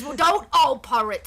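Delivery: loud Cockney pirate retort; Walcott Savage Soul Byron. Acted. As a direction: toss it back loudly in Cockney. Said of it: "He goes".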